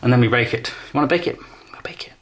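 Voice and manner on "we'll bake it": hushed whispered answer. Pretend. Answering his own question about baking, whispered. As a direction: breathe it like a secret.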